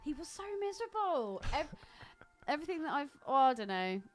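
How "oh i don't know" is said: usual speaking voice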